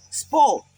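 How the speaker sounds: स्पो said crisply, the स only half, no vowel before it; spo with half s. Shown as a demonstration. Teacher showing स्पो with a half s. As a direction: clip the स into स्पो, no इस. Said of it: "पढ़ा जाएगा P से".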